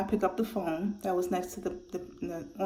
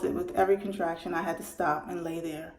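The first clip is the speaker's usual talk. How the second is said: ordinary talk, nothing marked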